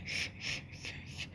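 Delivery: ش as a soft unvoiced hiss of air; light breathy sh. Shown as a demonstration. Teacher demonstrating the German ch: airy hiss. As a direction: push air for ش with no voice. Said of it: "شين خفيفة فيها هوا كده".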